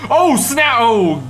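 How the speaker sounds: loud high yelp, then a slow sigh of shock; real startled reaction, high then settling; startled outcry. Real, not acted. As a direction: flinch and cry out. Flinched at a crash.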